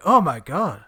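loud, gushing mock amazement; awestruck Sam. Co-host teasing Sam about loving the fountain show, mock awe. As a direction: imitate an over-thrilled fountain fan. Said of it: "It's just Sam there going".

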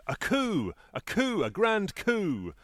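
high, drawn-out gloating cry; triumphant crowing. Acted. Lucy about to win the game, triumphantly crowing. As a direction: crow it in a high voice, stretching the end. Lucy crows as she wins.

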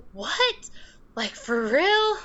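a hint of a shocked teenager; imitating teen daughter.

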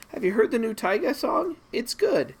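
high, bright imitation of Jamie; Jamie's voice. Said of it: "envision me watching it with Jamie after she goes".

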